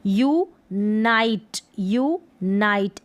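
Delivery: यूनाइट slowly split as "yoo... nite"; sounding out. Shown as a demonstration. Teacher reading unite for learners, slow sounding out. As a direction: say यूनाइट slowly in two separate parts. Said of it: "इसको भी यू पढ़ेंगे".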